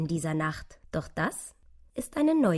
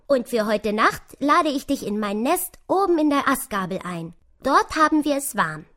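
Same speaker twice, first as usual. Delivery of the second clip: lively, louder, higher squirrel voice; einladendes Eichhörnchen; 7 semitones higher, 7 dB louder than usual. Acted. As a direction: invite the mouse in with energy. (lebhaft einladendes Eichhörnchen)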